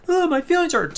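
high, hammed-up hurt whimper; mock crying whine. Mocking Darren Young crying in the ring, high hammed-up whimper. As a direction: play the crybaby, wounded and whiny. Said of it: "Darren actually starts crying".

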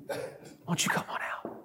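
furtive whisper, very soft; mock timid whisper. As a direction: whisper it so nobody watching overhears. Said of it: "don't wanna say this too loud".

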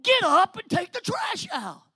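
high, loud mom voice barking an order; a mother ordering her lazy teen, loud and high; stern mom command. Retold or imitated. Mom ordering son off the couch, high loud command. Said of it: "she was saying without saying".

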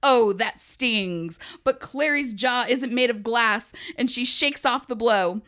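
loud play-by-play boxing commentary; old-timey announcer. Pretend. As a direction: call the fight like an old radio announcer. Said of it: "it's in the old-timey voice".

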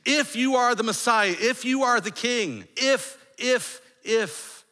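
high-pitched, insistent taunting; mocking jeer. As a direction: jeer at him, hammering each "if". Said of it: "they're all mocking him".

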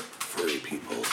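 low gravelly growl. Acted. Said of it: "that gravelly voice".

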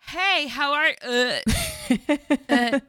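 high, halting mock American greeting; halting American accent. Mocking Emma Watson's stiff American line, halting accent. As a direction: stumble through it like a struggling actor. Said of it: "She's just like".

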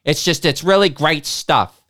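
lightly voiced Boston local; Boston accent. Man in the back explaining his taste, loud Boston accent. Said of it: "in a very thick Boston accent".

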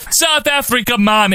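high-pitched hammed-up Jamaican accent; mock Jamaican accent. Podcaster quotes the announcer on the tour, mock Jamaican accent. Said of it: "in a Jamaican accent".

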